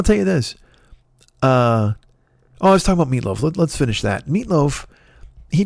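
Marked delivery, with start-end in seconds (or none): none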